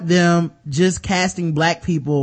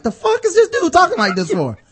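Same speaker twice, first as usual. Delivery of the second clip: baffled; 10 semitones higher than usual. Retold or imitated. Reenacts baffled reaction.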